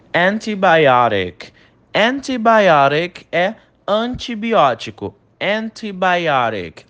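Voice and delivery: slow model antibiotic; careful English pronunciation. Shown as a demonstration. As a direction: spell out antibiotic slowly in English. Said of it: "Preste atenção na pronúncia". (pronúncia lenta e clara de antibiotic em inglês)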